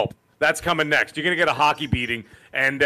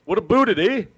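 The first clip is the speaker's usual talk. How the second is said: mock Canadian "about it, eh", a little higher; 4 semitones higher than usual. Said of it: "we don't all talk like that".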